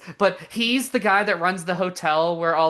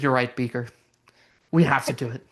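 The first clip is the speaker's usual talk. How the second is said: low, grave mock resolve; lightly voiced brooding scientist; solemn Oppenheimer; 6 semitones lower, faster than usual. Oppenheimer replying to Beaker, low mock gravitas. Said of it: "giving that, a great monologue".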